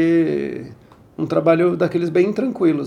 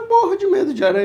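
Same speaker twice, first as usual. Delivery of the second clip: imitating a scared girl; 13 semitones higher, faster than usual. Frightened actress quoted.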